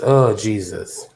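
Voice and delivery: normal talking voice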